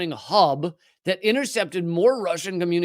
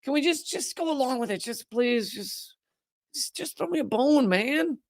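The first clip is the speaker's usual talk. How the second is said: mock begging newspaper; 7 semitones higher, 5 dB quieter than usual. Mocking New York Times coverage begging for a bone, soft whine. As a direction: whimper for a scrap of attention. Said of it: "coverage nowadays is just".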